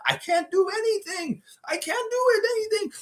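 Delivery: high, whiny protest; helpless whine. Retold or imitated. The superfan insisting he can't do anything, high and whiny.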